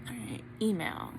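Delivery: hushed, to herself; barely voiced murmur; whispering. Real, not acted. Opening the email.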